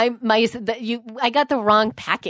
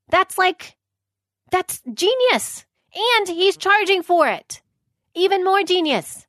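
higher, excited praise; 8 semitones higher than usual. Real, not acted. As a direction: gush with real excitement. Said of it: "I'm really excited".

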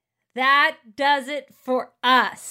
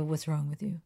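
put-on deadpan kid; 6 semitones lower, 8 dB quieter than usual. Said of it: "Declan literally will just look at me and go".

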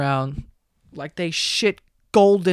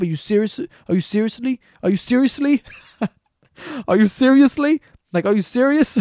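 repeated question building higher, breaking into a laugh; mock incredulous; 5 semitones higher than usual. The nerd taunting the girl, rising disbelief with a laugh. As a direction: keep asking, more incredulous each time.

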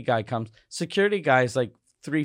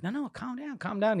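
high, soft hushing of an angry husband; wife calming him down; soothing wife; 7 semitones higher, 7 dB quieter than usual. Retold or imitated.

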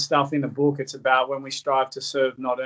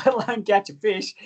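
laughing; 8 semitones higher than usual. Real, not acted. Laughing at himself on fishing show.

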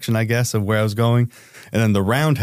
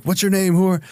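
high, pushy question; demanding agent; 9 semitones higher than usual. Retold or imitated. Agents finally asking his name, a mock demanding voice.

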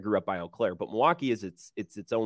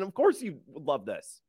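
ordinary talk

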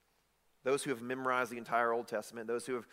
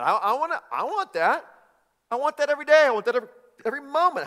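eager believer; 5 semitones higher, 10 dB louder than usual. Acted. Preacher voices an eager congregant.

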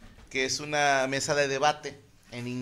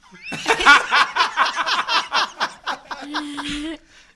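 long hearty laughter; 10 semitones higher, 9 dB louder than usual. Real, not acted. Long laugh at his son's excuse.

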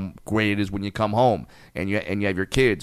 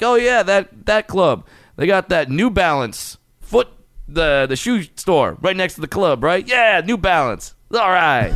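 hyped-up, higher-pitched shop talk; mock excitement; 7 semitones higher than usual. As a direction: act thrilled about a mundane store, pitched up. Mock excited talk about shoe store.

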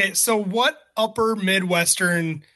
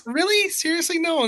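incredulous; 10 semitones higher than usual. Real, not acted. Disbelief at hot dish waste.

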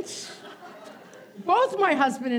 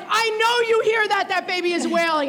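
raised, pitched-up exasperation; exasperated; 6 semitones higher, 4 dB louder than usual. Retold or imitated. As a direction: call him out, high and loud. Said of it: "you're like".

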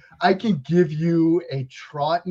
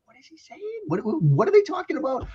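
high, baffled voice of someone lost in the dialogue; confused moviegoers; 13 semitones higher than usual. Retold or imitated. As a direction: play a puzzled viewer who can't follow the Boston accents. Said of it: "everybody else is like".

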